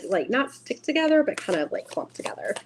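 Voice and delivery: normal talking voice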